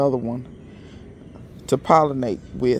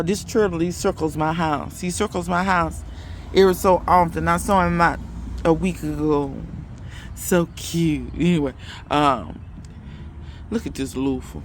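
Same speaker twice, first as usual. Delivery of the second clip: normal talking voice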